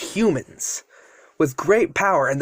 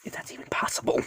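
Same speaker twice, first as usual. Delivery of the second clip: soft, whispered afterthought; whispered aside; 10 dB quieter, faster than usual. Real, not acted. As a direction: drop to a whisper for a doubtful aside. Doubting aside in the story.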